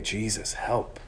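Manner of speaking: soft, hushed plea for help; desperate prayer. Pretend. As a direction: whisper it like a last prayer. Voicing the short prayer again.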